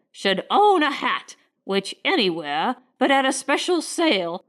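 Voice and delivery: high, indignant tirade of a haughty lady; a society matron fuming at a servant's hat; outraged lady. Acted. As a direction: pitch it up in scandalised outrage.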